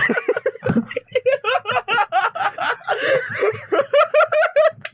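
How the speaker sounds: long helpless high-pitched laugh; high laughing fit. Real, not acted. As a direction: dissolve into giggles. Cracking up at the joke.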